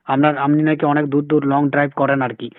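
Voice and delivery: ordinary talk, nothing marked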